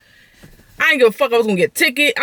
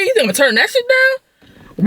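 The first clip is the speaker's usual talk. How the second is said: higher and slower, drawn-out disbelief; incredulous; 12 semitones higher than usual. Retold or imitated. Weighing the free offer, incredulous and high. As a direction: stretch out the disbelief. Retelling her own reasoning.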